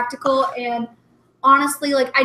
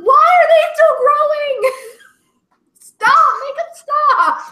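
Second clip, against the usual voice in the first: high, loud drawn-out wail; hammed-up distraught cry breaking into laughter; mock wailing protest; 13 semitones higher, 8 dB louder, slower than usual. Teacher complaining to a mom about growing kids, mock wailing. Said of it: "went up to one of their moms and said".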